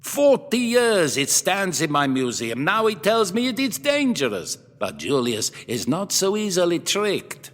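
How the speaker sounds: put-on high, theatrical Middle European accent; dramatic Middle European collector. Museum owner mocking the professor's warning, theatrical Middle European accent. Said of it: "flung out one hand in a dramatic gesture".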